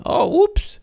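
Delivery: high, squeaky fake oops; mock innocence. Host voicing the sneaky company's fake oops, high and squeaky. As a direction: play the sneaky company pretending it was an accident.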